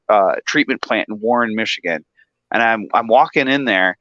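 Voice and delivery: usual speaking voice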